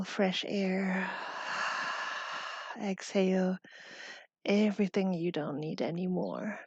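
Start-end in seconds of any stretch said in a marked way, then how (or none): 1.1-2.8 long, audible breath in; deep inhale. Shown as a demonstration. Yoga teacher leading the class to breathe in fresh air, deep inhale. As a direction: take in a slow, deep breath.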